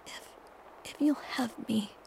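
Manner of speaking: soft trembling whisper. A narrator's reading. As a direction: keep the shaky whisper going. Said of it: "I confess in a trembling whisper".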